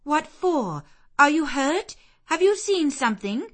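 high, loud, urgent questions; demanding. Acted. Bessie questioning the frightened girl, urgently demanding. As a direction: press her for an answer. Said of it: "again demanded Bessie".

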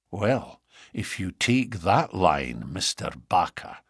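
quiet. A narrator's reading. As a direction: say it quietly and deliberately, voice dropped. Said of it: "said the inspector quietly".

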